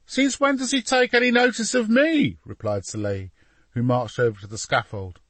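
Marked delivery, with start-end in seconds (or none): 0.1-2.4 very high, louder youngster's voice; exasperated boy. A narrator's reading. Boy asked to persuade his papa, exasperated. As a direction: protest in a high, indignant pitch.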